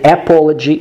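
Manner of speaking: "apology" with a full "a" as in cat at the start; mispronounced "apology" with no schwa; wrong pronunciation demo. Shown as a demonstration. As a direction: open "apology" on a clear æ, rounded o after. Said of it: "that's wrong".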